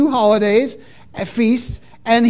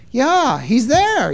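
drawn-out, sing-song Minnesota vowels; slow, swooping Minnesotan lilt; Minnesota accent; 3 semitones higher, slower than usual. Acted. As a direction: stretch it out like a Minnesotan. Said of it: "as they say in Minnesota".

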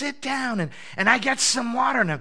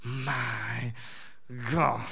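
stunned realisation; 7 semitones lower, 5 dB quieter, much slower than usual. Retold or imitated. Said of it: "I'm sitting there saying".

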